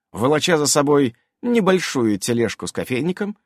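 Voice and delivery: ordinary delivery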